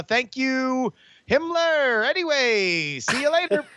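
bright high sign-off; high sing-song goodbye. Pretend. Cheery hangup.

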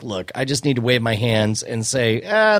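ordinary talk, nothing marked

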